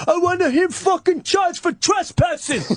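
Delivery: very high shrill mock rant; mimicking the pizza man's tantrum; angry neighbor. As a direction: squeal it like an outraged neighbor. Pizza man yelling in background.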